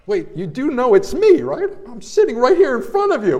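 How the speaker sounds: acted-out "are you serious" protest; incredulous protest. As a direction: retell it as an indignant, high-pitched objection. Retold protest at being talked about.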